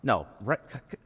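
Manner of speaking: high, fast, stammering plea; panicked pleading. Acted. Suspect pleading with the arresting officer, panicked. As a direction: beg to be let go as the cuffs close.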